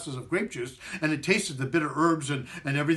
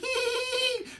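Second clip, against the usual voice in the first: very high little giggle; squeaky giggle. Acted. Matzo man before hopping off, high squeaky giggle. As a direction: giggle like a tiny toy. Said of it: "squeaked the matzo man".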